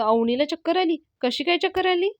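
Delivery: high-pitched alarmed questions; panicked. A narrator's reading. Yasha hears Avni got dizzy, panicked. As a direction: ask in rising alarm, pitch climbing. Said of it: "तो खूप panic होतो".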